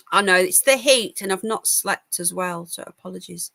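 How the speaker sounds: usual speaking voice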